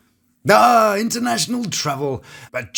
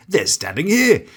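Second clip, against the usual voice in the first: ordinary talk, nothing marked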